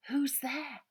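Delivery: plain reading voice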